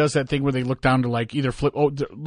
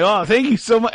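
high, louder, gushing thanks; grateful sushi chef; 9 semitones higher, 5 dB louder than usual. Retold or imitated. The sushi chef thanking him for the recycled tip, gushing high. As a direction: imitate the chef, delighted by the tip. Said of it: "the sushi chef would be like".

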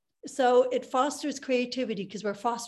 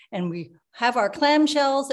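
usual speaking voice

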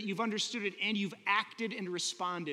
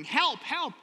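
lightly acted call for help, a little higher and louder; cry for help; 6 semitones higher, 4 dB louder than usual. Preacher imitating someone crying for help, mild mock call. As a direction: call out like someone stuck in a hole, only half-voiced. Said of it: "the sound of the person crying".

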